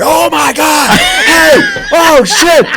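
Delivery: high, loud startled yell; startled shout. Retold or imitated. Javier startled in his chair, shrieking high and loud. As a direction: jump out of your skin and shout. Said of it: "jumps out the fucking chair".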